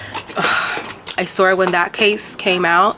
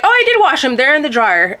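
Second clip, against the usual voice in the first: high, breezy mock innocence; innocent retort; 6 semitones higher than usual. Wife telling husband the shirts are still in the dryer, breezy and high. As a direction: play it off like nothing's wrong. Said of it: "I'd be like".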